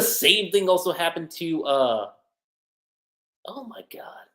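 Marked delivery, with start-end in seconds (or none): 3.5-4.3 hushed whisper of dawning realization; whispered shock. Real, not acted. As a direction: breathe it out under your breath. Sudden realization mid-thought.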